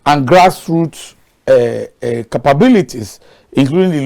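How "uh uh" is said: ordinary talk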